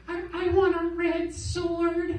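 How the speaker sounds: put-on slow, high little-kid voice; the boy asking the balloon guy for a sword, small and high. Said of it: "and he's like".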